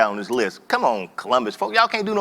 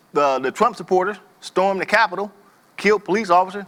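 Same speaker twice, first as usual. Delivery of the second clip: ordinary talk, nothing marked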